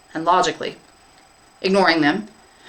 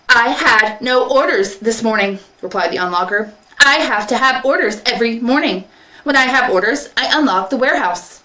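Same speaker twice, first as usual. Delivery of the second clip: unlocker robot; 5 semitones higher, 4 dB louder than usual. Acted. Unlocker explaining to the field minder, literal. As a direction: answer like a simple key machine.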